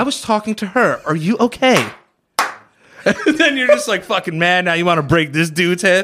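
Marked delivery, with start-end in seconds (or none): 0.0-1.8 interfering passerby. Acted. Passerby addresses the woman over man.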